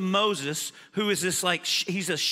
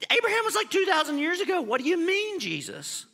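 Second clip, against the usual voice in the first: incredulous opponents; 10 semitones higher than usual. Acted. Opponents' disbelief voiced.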